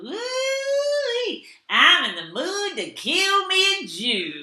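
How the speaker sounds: high, drawn-out whoop sliding into a drawl; imitated heckler's yell. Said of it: "and the audience yelled".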